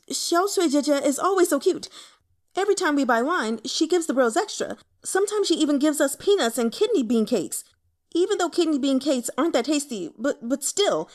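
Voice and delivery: high, loud, fast babble; excited rambling. Acted. Young man rambling about the barmaid, high and loud. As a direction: gush on without stopping.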